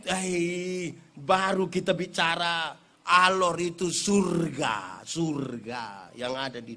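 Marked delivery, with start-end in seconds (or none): none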